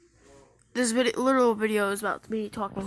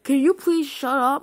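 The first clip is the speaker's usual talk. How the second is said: loud, higher retold snap; mock irritated demand; annoyed gamer; 5 semitones higher, 8 dB louder than usual. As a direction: imitate a fed-up player shutting someone down. Retells annoyed gamer telling him off.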